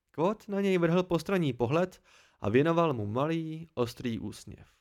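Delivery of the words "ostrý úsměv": ordinary delivery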